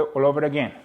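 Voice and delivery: ordinary talk, nothing marked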